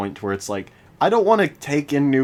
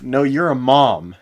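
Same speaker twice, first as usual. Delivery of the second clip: slow teasing; 2 semitones higher, 8 dB louder, slower than usual. Pretend. Slow teasing drawn-out insult.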